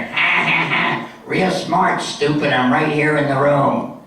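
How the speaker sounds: cackle, then heckle in a put-on voice; Mitzi. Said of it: "And she talks like this".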